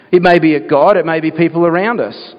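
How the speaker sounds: usual speaking voice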